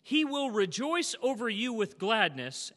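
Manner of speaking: slow, loud proclaimed scripture; booming pulpit reading of the verse. Acted. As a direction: read it out like a proclamation. Reading scripture aloud in sermon.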